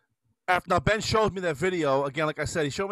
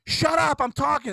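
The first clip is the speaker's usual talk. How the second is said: mother snapping, a touch higher; mock yell; 8 semitones higher than usual. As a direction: shush him mid-call, lightly raised. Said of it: "she's yelling at Ben going".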